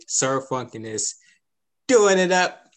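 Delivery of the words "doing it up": loud, high hype; big hype finish; hyped up. Acted. Hype exclamation.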